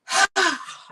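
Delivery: loud sighing breath out; big sigh out. Shown as a demonstration. Instructor showing the big out-breath sigh. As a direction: let it all go on the out-breath. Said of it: "breathe out with a big".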